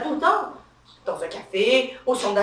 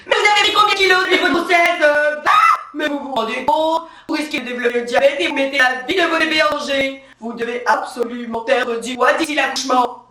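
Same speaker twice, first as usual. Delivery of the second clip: scolding nurse; 3 semitones higher than usual. Acted. Nurse bawling out a pregnant woman over weight, scolding. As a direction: start shrieking, then lecture her firmly. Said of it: "j'entends l'infirmière engueuler la femme enceinte".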